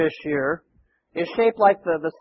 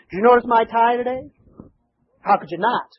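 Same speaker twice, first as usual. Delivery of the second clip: ordinary delivery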